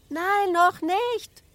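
put-on very high, drawn-out child's cry; little girl shouting. A narrator's reading. Little Ida refuses to come down yet, shouting. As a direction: stretch it out slowly in a high, calling voice. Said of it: "schrie Ida".

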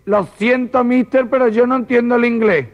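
high-pitched comic apology; high character voice, apologetic. Acted. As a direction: play the Virgin answering from above, voice lifted. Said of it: "muy gitana, muy morena, y dice".

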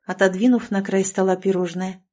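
usual speaking voice

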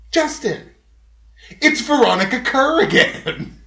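high call of a name; customer calling out. Retold or imitated. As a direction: call his name sharply down the phone. Customer calls out his name.

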